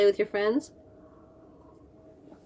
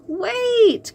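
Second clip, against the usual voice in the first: high call, much higher; 13 semitones higher, 4 dB louder than usual. A narrator's reading. Pete calling after his speeding robot, high call. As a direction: call after him urgently. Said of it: "called Pete".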